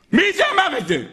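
high, startled demand; indignant question. Acted. Heaven asks who revealed the secret, startled demand. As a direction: demand an answer with pitch leaping.

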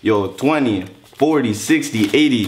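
hammed-up gangster boasting; fake tough gangster. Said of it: "It's your boy [name]".

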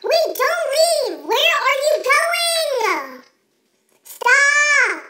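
loud, high, slow pleading call; calling after her. Acted. Girl calling after her friend as she walks off, loud pleading shout.

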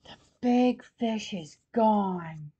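ordinary delivery, nothing marked

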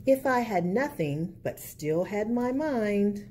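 put-on lilting picture-book narration for kids; reading to children. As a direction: read it like storytime at the library.

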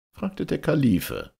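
ordinary delivery

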